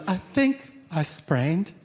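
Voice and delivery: mock the hurt wife gently; a touch of a pained plea; imitating wife. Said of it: "And she goes".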